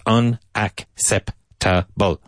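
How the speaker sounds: very slow, every syllable of Unacceptable spelled out; slow drill word. Shown as a demonstration. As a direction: pull Unacceptable apart syllable by syllable. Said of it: "so there's a lot of syllables".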